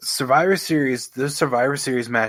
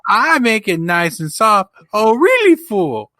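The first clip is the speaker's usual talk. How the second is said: Billy Madison; 11 semitones higher, 4 dB louder than usual. Acted. Co-host quoting a comedy film to his friend, high silly impression. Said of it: "Billy Madison".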